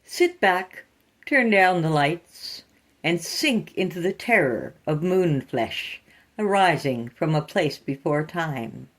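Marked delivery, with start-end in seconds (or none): none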